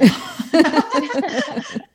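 loud, high laugh; real amused laughter, high and loud. Real, not acted. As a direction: burst out laughing. Laughs after dodging the hard question.

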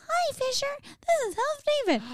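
high squeaky elf voice. Retold or imitated. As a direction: pitch way up like a tiny elf. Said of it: "And you're like".

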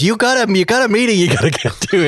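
a touch of a bored wife hurrying him; lightly voiced wife. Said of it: "His wife ... She goes".

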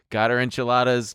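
usual speaking voice

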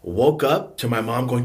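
ordinary talk

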